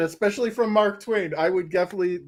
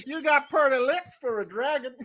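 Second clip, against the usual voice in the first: hillbilly taunt; 6 semitones higher than usual. Acted. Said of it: "he's like".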